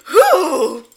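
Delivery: high, loud silly ooh; imitating Aaron's ooh. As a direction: mimic Aaron's startled ooh. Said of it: "that was the sound he made".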